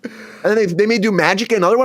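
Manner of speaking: usual speaking voice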